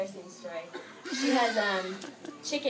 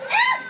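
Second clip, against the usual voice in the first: high, loud squealing scream; imitating the sister startled by chickens, a loud high shriek; mock scream; 12 dB louder than usual. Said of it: "screams and jumps back".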